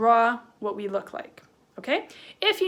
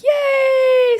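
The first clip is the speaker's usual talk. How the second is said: loud, very high shouted cheer; super excited; 14 semitones higher, 13 dB louder than usual. Shown as a demonstration. Teacher drawing a happy face, excited shout. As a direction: whoop it out in delight. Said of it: "We're super excited".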